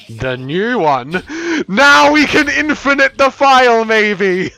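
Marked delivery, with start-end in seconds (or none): 1.7-4.5 loud, high hyped gamer voice; excited. Real, not acted. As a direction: build up the big experiment with excitement. Excited reveal during the game.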